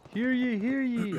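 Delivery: loud, high proclamation call; town crier. Pretend. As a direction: bellow it like announcing in the square. Calling out to check mic.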